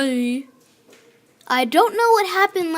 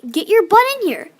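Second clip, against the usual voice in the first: angry command; 3 semitones higher, 5 dB louder than usual. Real, not acted. Scolding Doctor Treoris to get inside, angry and loud. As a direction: lose patience and demand he come in.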